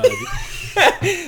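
high, slow laughing voice. Real, not acted. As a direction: laugh through the words.

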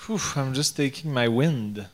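ordinary talk, nothing marked

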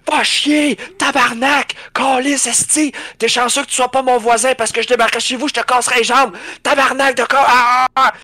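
high furious yelling and swearing; screaming in rage. Retold or imitated. Alone in the basement, screaming curses at the boss. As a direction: scream it out like a raging gamer. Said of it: "je commence à crier".

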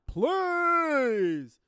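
high, loud, stretched wail; desperate pleading. Acted. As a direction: beg in a long howl. Begging for lockdown to end.

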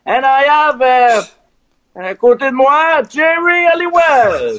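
high, slow, earnest English of a francophone; nervous radio guy fumbling in English; awkward English on air. Retold or imitated. On air, a French radio guy nervously introduces himself in English.

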